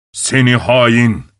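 slow, drawn-out angry accusation; angry shout. A narrator's reading. Husband accusing his wife, slow restrained fury. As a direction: spit fury without raising the volume much. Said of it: "diye öfkeyle bağırmış".

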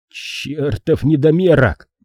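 slow, drawn-out angry growl; growled insult. A narrator's reading. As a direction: growl it slowly at your rival. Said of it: "прорычал Шейн". (злой протяжный рык)

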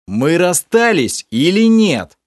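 high, slow, thundering bark; loud bark. A narrator's reading. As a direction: roar the question at her. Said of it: "громогласно рявкнул я". (громогласно рявкнуть)

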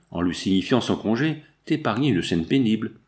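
plain reading voice

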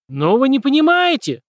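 high loud wail. A narrator's reading. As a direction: cry out in protest, high. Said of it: "возопил Дональд".

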